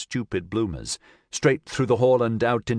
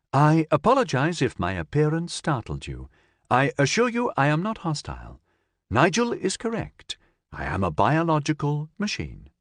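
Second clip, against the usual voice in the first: polite android; 6 semitones higher than usual. Acted. Android speaks to Cassandra.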